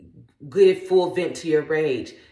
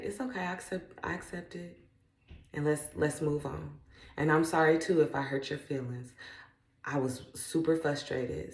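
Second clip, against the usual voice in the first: very soft, tender forgiveness; soft apology; 2 semitones lower, 9 dB quieter than usual. Retold or imitated. Mother forgiving her child after scolding, very soft and warm. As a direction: murmur it like a hug. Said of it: "I say".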